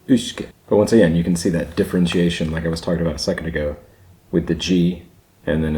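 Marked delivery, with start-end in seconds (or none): 0.1-0.5 "Uisce" said "ooshka", pitched up; "oo" first vowel. Shown as a demonstration. Learner repeating the Gaelic form, "Uisce" begun with "oo". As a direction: round the opening of "Uisce" into "oo". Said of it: "not isce".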